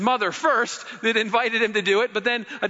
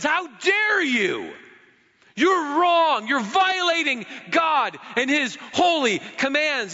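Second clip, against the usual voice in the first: indignant scolding; 6 semitones higher than usual. Retold or imitated. Religious crowd scolding Jesus for picking grain. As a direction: scold him like a scandalized religious elder.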